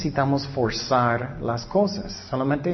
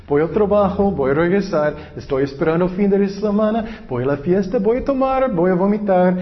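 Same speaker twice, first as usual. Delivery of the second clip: higher, louder sing-song mockery of an empty life; mocking routine; 5 semitones higher, 4 dB louder than usual. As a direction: parody a pointless weekly routine, grinning. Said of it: "Qué ridículo". (burla cantarina, más aguda y fuerte)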